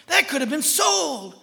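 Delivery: loud, high griping protest. Retold or imitated. Judas objecting to ointment on Jesus' feet, loud griping. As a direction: gripe loudly with indignation. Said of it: "the main person griping about the ointment".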